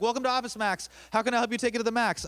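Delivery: high, loud chirpy store greeting; forced retail greeting. Retold or imitated. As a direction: chirp it like a cheerful cashier on autopilot. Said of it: "I'd be like".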